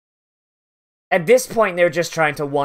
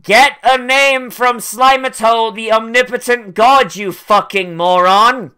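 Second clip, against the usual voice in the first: exasperated shouting; 4 semitones higher, 5 dB louder than usual. Real, not acted. Yelling at a character to get a name, exasperated.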